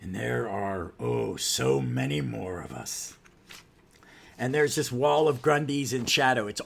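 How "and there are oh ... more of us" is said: slow, put-on menacing villain. Villain revealing many more like him to the heroes, slow ominous voice. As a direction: draw the words out ominously. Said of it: "And then there we goes".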